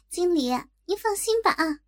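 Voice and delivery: high, giggly reassurance; laugh, then reassure in a high lilt; laughing young staffer. A narrator's reading. Staffer tells her departing manager not to worry, laughing high voice.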